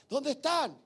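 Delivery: sudden high shout at the pastor; retold shout. Young [name] shouting at his pastor, frustrated yell. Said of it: "Yo me acuerdo que le grité".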